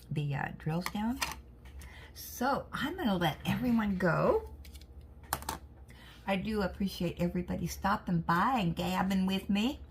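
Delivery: ordinary talk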